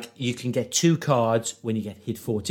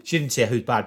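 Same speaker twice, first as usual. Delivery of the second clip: usual speaking voice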